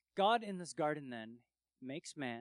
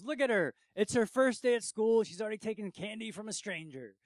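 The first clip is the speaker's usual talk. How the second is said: raised, higher voice, teasing the whole room; playful loud joke; 6 semitones higher, 9 dB louder than usual. Retold or imitated. Drew teasing the girl before the watching room, loud and playful. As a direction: call it out to the crowd like a jokester.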